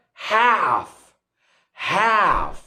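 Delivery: "have" with the A of cat; American front vowel in "have"; American A sound. Shown as a demonstration. Teacher drilling the word for learners, American "have". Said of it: "difference between the American accent and the British accent".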